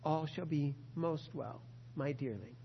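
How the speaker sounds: hushed and slow, barely voiced; whispered. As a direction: whisper it softly at a deathbed. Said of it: "whispered it to her".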